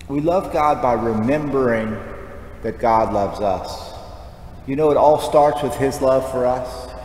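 usual speaking voice

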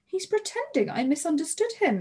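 high-pitched exasperation; indignant woman, pitch shooting up; indignant exclamation. A narrator's reading. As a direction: exclaim in affronted disbelief. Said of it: "Missus Mendoza exclaimed".

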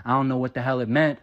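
usual speaking voice

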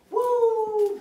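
loud high whoop; excited cheer. Real, not acted. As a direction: cheer the jump loudly. Cheering dog's jump.

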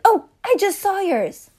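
bright high-pitched character line; chirpy guest. Acted. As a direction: pipe it up high like a sweet guest. Character voiced at name tags.

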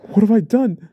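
high, loud, stricken cry; loud despairing question; anguished shout. Acted. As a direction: shout it out in horror. Man horrified at what he did.

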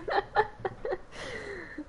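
high-pitched burst of laughter. Real, not acted. Big laugh.

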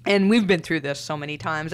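ordinary delivery

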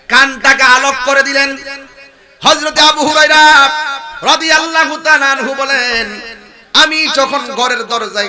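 loud, high chanted sermon delivery; full-force preaching. Real, not acted. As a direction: proclaim the story to a crowd. (উঁচু গলায় সুরেলা ওয়াজ)

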